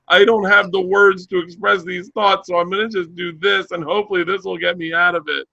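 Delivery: high-pitched tearful plea, put on; mock crying. Husband giving up in a fight, high mock-tearful voice. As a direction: fake breaking down while explaining yourself. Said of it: "if I started crying".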